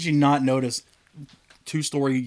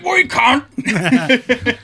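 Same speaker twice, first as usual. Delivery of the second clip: very slow, stretched yell, higher and louder; drawn-out mock shout; 8 semitones higher, 6 dB louder, much slower than usual. Mock guard insulting the crowd, slow stretched yell. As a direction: drag out each word like a mock guard.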